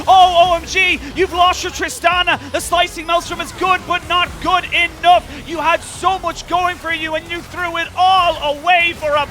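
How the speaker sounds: high, excited caster call; esports play-by-play. Real, not acted. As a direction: shout the costly mistake to the crowd. Shouts live esports play-by-play.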